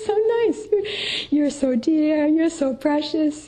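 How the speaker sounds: put-on high doting coo over a delighted child; cooing mom. Mother adoring her happy child, high cooing. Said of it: "the child is like".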